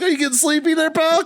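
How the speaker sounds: high, teasing jab at the worn-out drinkers; mock taunt. Marine taunting the bartenders at six a.m., high, mocking tease.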